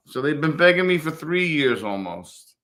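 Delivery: ordinary talk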